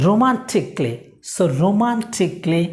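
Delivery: romantically as ro-man-tik-lee, A silent; demonstrated pronunciation. Shown as a demonstration. Teacher introducing the next word to learners, romantically without the A. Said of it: "উচ্চারণটা শিখিয়ে দিয়েছি".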